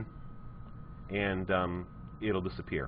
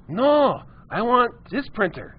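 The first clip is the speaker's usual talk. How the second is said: much higher, slow and stubborn refusal; stubborn old customer refusing, high voice; high insistent customer; 11 semitones higher, 3 dB louder, slower than usual. Retold or imitated. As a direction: squeak out a flat no like a stubborn customer. Customer voiced in retold story.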